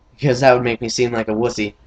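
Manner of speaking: ordinary talk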